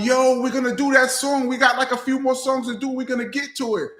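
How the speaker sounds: high, fast voice stalling the crowd; imitating Eric. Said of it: "And Eric was going".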